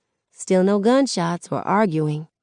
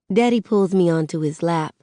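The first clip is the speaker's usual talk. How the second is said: ordinary delivery, nothing marked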